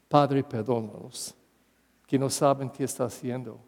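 hushed prayer from the cross; tender quote. Acted. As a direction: breathe out Jesus's plea to the Father. Quoting Jesus on the cross.

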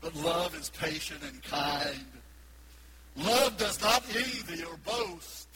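slow, deliberate, love stressed; weighty scripture. Real, not acted. Preacher reading scripture, slow and weighty. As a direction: read it with weight.

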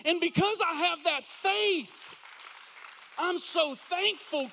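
loud, rising preacher's cry; full-force preaching. Real, not acted. Pastor preaching thankfulness to church, loud and rising. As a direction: drive it home to the congregation.

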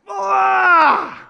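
loud, high fed-up "blah" yell; exasperated blurt of "blah"; frustrated blah. Shown as a demonstration. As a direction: shout the blahs out. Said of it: "So we call it the blahs".

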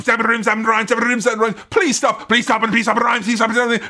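high, rapid-fire mock chant; auctioneer patter. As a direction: rattle it off relentlessly like an auctioneer. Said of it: "It sounds like auctioneers".

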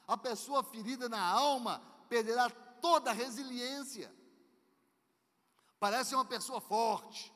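loud, high fiery sermon; fiery preaching. Real, not acted. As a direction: preach it at full force. Preaching at full force.

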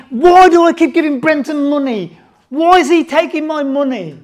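loud mock-grumbling partner demanding why he pays; indignant complaint. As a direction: protest it like a man who feels cheated. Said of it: "and I'm saying".

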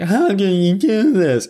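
loud, slow, high mock wail; mock outrage. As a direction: drag out her accusation in a loud, high parody. Mimicking the daughter's accusation.

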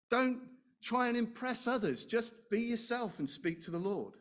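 normal talking voice

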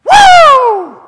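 very high, loud whoop of delight; joyful whoop. Real, not acted. Pastor recalling being loved, a loud happy whoop. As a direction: let out a whoop of joy.

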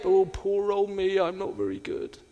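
a hint of a fawning Uriah Heep, quiet; Uriah Heep whine. Preacher mimics self-pitying Uriah Heep, soft whine. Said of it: "we're like Uriah Heep".

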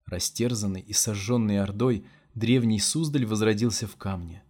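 plain reading voice

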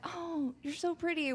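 high, soft, slow gushing; high soft mock cooing; cooing at a pretty girl. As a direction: gush over her sweetly. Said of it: "We're just like".

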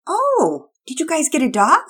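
high, loud delighted surprise; a lady spotting a new dog; surprised neighbor. Acted. Missus Hicks surprised.

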